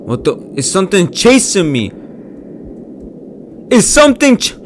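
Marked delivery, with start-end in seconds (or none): 3.7-4.5 high, loud frightened cutoff; scared cry. Real, not acted. Streamer thinks something chases him, scared cry. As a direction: panic and cut yourself off.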